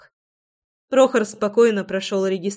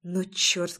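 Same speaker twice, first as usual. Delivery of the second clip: usual speaking voice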